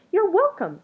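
high, loud, bubbly creature voice; an eager little creature bursting with friendliness; cheery creature. A narrator's reading. Creature greets Something Else at the door, high and eager. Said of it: "said the creature".

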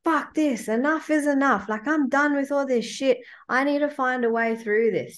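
fed up. Retold or imitated. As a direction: blurt it out like you've had enough. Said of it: "And I was like".

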